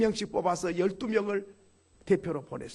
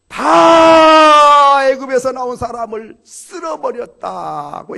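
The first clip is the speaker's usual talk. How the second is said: huge drawn-out shout on the first word; slow, booming climax of a sermon; full-force preaching; 5 semitones higher, 18 dB louder, slower than usual. Real, not acted. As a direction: bellow it out to the congregation.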